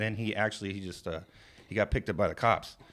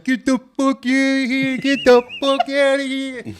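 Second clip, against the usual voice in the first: slow, high, loud muffled yell; mock deaf woman's garbled shout; deaf mother-in-law; 14 dB louder, slower than usual. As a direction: holler with words blurred as through peanut butter. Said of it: "she's so deaf, every time she talks".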